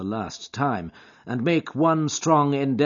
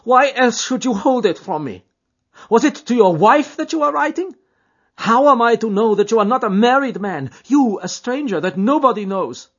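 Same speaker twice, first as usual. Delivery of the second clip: put-on high, loud jealous girl's voice; jealous young woman; 13 semitones higher, 11 dB louder than usual. A narrator's reading. Etty accusing Jack of a secret wife, high jealous voice. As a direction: accuse him, hurt and angry.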